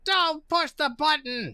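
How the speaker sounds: high, slow gargoyle voice; gargoyle warning. Acted. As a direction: warn them as a gargoyle, stretching the words. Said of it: "Gargoyle three is here".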